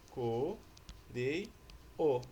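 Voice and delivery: "Co-rei-o" chopped into syllables with clear pauses. Shown as a demonstration. Teacher breaking up the word so pupils understand, "Co-rei-o" syllable by syllable. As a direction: say "Co-rei-o" one isolated syllable at a time. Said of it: "professor tá falando desse jeito pra vocês entenderem".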